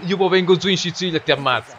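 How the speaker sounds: ordinary delivery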